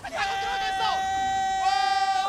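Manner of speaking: loud amazed yell; excited shout. Real, not acted. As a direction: roar at the big play. Big play reaction.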